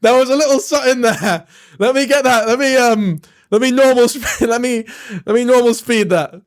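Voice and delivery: loud, high and laughing, can't contain it; excited shouting. Real, not acted. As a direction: blurt it out, cracking up at the slip. Excitedly pointing out slip, laughing.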